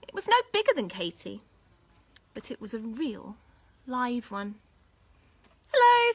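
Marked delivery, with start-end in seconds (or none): 5.7-6.1 much higher, loud hello; excited hello. Acted. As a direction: burst out with delight. Katy surprised meeting dinosaur.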